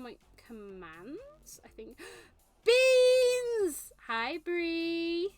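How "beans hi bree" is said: high, drawn-out gleeful shout; excited shout. Real, not acted. Streamer calls out to someone arriving, excited shout. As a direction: yell it out and stretch the vowel.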